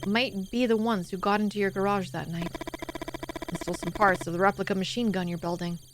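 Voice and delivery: subdued, low narrator read; story narration. A narrator's reading. Reads the game's story aloud.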